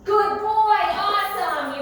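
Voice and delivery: high, loud drawn-out praise. Real, not acted. Trainer rewarding Ernie with a treat, loud high praise. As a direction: reward him with a big happy voice.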